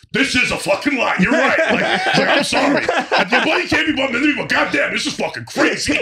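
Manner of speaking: gushing at the visuals, half laughing; awed excitement. Retold or imitated. Boston guy looks up at the Sphere, awestruck and laughing.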